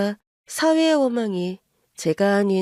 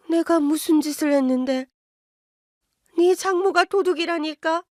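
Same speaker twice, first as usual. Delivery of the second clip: higher, scolding mother berating her son; mother scolding her son for siding with in-laws, higher and indignant; 7 semitones higher than usual. Acted. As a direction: nag him, pitch climbing with outrage. Mother voice again.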